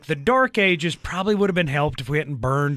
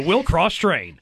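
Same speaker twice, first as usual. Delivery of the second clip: normal talking voice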